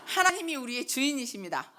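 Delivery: preacher's ringing call for a response; emphatic declaration. Shown as a demonstration. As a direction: proclaim it firmly to draw a prompt amen. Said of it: "다시".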